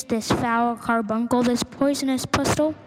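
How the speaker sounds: ordinary delivery, nothing marked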